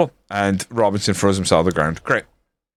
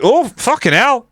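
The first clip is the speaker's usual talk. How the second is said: high, drawn-out swearing; real startled outburst; 12 semitones higher, 3 dB louder than usual. Real, not acted. Player watching the match go wrong, startled disbelief. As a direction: blurt it slowly, pitched way up.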